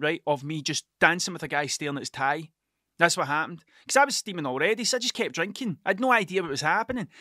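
ordinary talk, nothing marked

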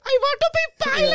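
very high, loud mock kid voice; imitating a child from a video; little kid. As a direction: shout it like a little kid with an accent. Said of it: "interviewing a bunch of little kids".

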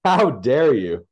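loud, slow theatrical indignation; hammed-up scandalized cry; mock outrage. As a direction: act hugely offended, drawing out each word. Mock offended reply to correction.

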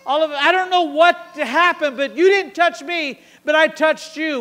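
loud, high, breathless confession; excited testimony. Acted. Healed woman telling Jesus she touched him, excited. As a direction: burst out with what just happened to you.

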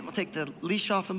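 normal talking voice

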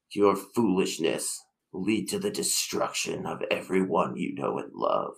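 icy, unhurried threat from a time-traveller; cold voice. Acted. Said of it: "He says in a cold voice".

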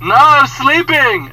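loud, high, drawn-out mock yell; annoyed guest. Sleeping guest refusing the maid, mock annoyed yell. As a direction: holler it slowly from bed.

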